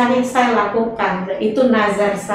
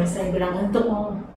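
normal talking voice

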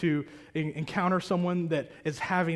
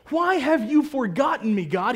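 crying out; 6 semitones higher, 4 dB louder than usual. Retold or imitated. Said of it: "he cries out and says".

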